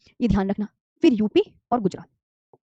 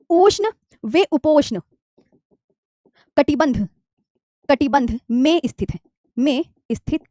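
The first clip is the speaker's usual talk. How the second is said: slow dictation; slower than usual. Shown as a demonstration. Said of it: "आगे बात कर लेते हैं".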